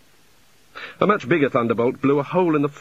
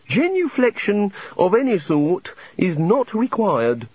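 gracious god; 8 semitones higher, slower than usual. Acted.

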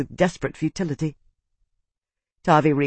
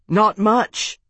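higher, moderately raised call; shout back; 5 semitones higher than usual. A narrator's reading. Tavi answers the slave through the storm, mild shout back. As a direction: call back over the storm, not full force. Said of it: "and to shout back".

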